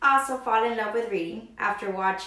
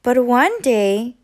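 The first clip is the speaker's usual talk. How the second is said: plain reading voice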